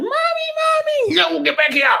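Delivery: put-on high-pitched kid yell; imitated shouting kids. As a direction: imitate children calling out in distress.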